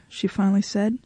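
ordinary delivery

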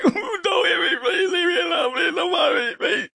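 whimper-shout like a beaten coward; mock begging. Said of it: "They should be like".

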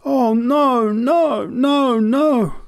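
anguished high cry, loud; desperate wailing cry of no; cried out. A narrator's reading. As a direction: fling the head back and wail the no's. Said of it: "cried out".

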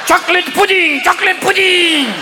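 high, sustained stadium call; demented Pope. Acted. Confused Pope in Yankee Stadium, high chanting proclamation. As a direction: proclaim it like a senile pontiff blessing crowds.

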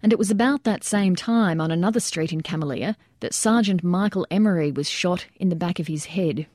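plain reading voice

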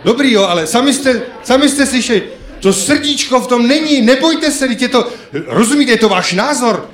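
high, loud mock telling-off; mock scolding shout. Comedian chiding the audience for singing without heart, loud and high. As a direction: pretend to scold the crowd for weak singing.

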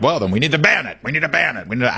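high-pitched mock outcry, repeated insistently; clamoring Democrat. Host mocks a Democrat demanding a ban, high mock outcry. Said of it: "he's a Democrat".

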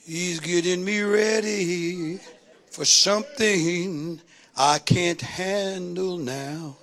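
tempted, weakening. Acted. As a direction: draw it out slowly, as if giving in. Acts out yielding to temptation.

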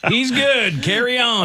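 high, called-out dismissal through laughter; joking high-pitched official verdict; mock cop all-clear. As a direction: play a cop waving someone on. Acts cop dad clearing the boyfriend.

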